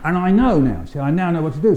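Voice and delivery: usual speaking voice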